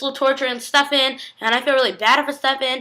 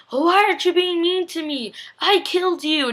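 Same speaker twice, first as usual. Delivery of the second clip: higher-pitched questioning, acted suspicion; suspicious; 8 semitones higher than usual. Retelling a character questioning someone's kindness, mock suspicious. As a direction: imitate someone wary and puzzled. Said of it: "really suspicious".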